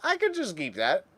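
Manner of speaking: mock tempted voice. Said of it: "we're like".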